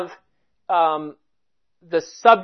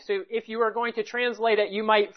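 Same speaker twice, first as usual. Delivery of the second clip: ordinary talk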